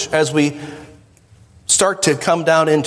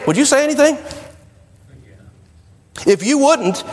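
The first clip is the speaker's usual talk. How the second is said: ordinary delivery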